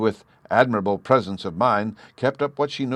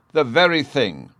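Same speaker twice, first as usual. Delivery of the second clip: higher, louder delighted cry; excited exclamation; 6 semitones higher, 5 dB louder than usual. A narrator's reading. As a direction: burst out with enthusiasm. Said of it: "she exclaimed".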